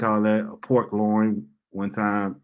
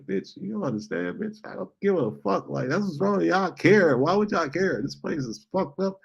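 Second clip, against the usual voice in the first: higher, fed-up rant; exasperated inner rant; 8 semitones higher than usual. Retold or imitated. His unspoken thoughts toward the lady, a higher fed-up rant. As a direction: vent it all, pitched up. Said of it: "I'm like".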